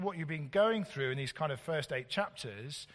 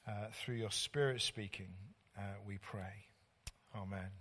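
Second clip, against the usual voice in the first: prayer; 3 semitones lower than usual. Real, not acted. Leads an opening prayer.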